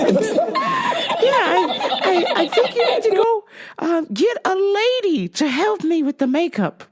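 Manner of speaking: high voice breaking into laughter; laughing through a quote. Retold or imitated. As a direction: try to say it but keep cracking up. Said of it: "And I was like".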